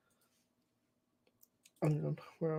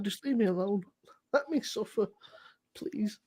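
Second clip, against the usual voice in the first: mock hungover moan; 4 semitones lower, 13 dB quieter than usual. Imitating his reply to the friend texting him while hungover, a soft moan. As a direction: play the suffering victim, half in jest. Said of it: "I was like".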